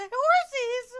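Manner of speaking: high, loud delighted shout; excited squeal. Real, not acted. Streamer spotting horses, shrieking with delight.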